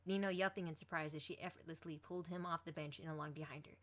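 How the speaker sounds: plain reading voice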